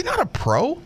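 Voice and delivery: high verdict, lifting at the end. Real, not acted. Host judging what players say in interviews, high rising verdict. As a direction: deliver it high without pushing the volume.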